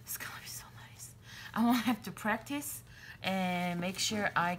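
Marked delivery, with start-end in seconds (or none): 0.0-1.1 quick, hushed delight; excited whisper. Real, not acted. Crafter imagining her new journal cover, delighted whisper. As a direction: whisper it fast, relishing the thought.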